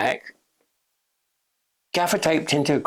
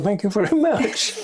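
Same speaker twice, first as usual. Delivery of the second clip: higher, laughing through the thanks; laughing reply; 8 semitones higher than usual. Retold or imitated. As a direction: chuckle out a mock-grateful thanks. Retold dry reply to the actor.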